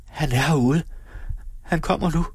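high, urgent, out of breath; hushed excitement, short of breath; breathless whisper. A narrator's reading. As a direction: report it breathlessly. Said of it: "hviskede hun stakåndet".

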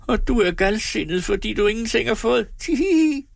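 mock hysterical woman tittering; hysterical laughing. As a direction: taunt him, then burst into giggles. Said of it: "Tripstine lå hysterisk".

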